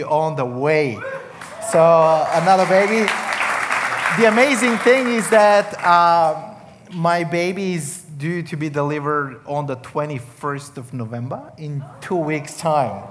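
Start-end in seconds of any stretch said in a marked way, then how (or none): none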